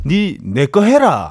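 Busan dialect, slowly and deliberately; teacher modelling Busan dialect slowly; slow Busan dialect demo. Shown as a demonstration. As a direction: model the Gyeongsang line slowly for the learner. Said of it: "아니".

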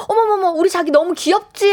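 high-pitched, hammed-up doting; gushing endearment. Shown as a demonstration. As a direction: fuss adoringly over your darling. 남편에게 애교 부리는 시범. (호들갑스러운 애교 말투)

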